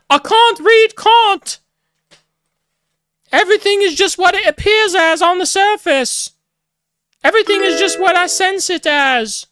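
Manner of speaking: very high, dopey sneering caricature; mocking squeaky voice. Ridiculing MI6 in a high squeaky caricature. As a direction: play them as clueless, squeaking about Kant. Mocking MI6 types who can't read Kant.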